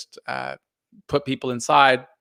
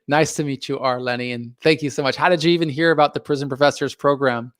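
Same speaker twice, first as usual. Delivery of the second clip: normal talking voice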